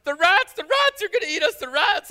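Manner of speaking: put-on high, loud panicked kids' cry; kids yelling in panic. Pastor imitating kids screaming about rats. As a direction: shriek it like scared children in the dark. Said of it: "the kids are yelling".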